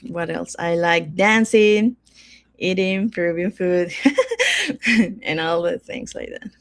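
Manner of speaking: normal talking voice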